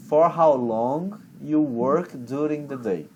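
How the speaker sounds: slow, deliberate question; teacher slowly modelling the English question; slow teacher model. Shown as a demonstration. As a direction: model each word slowly. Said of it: "Então".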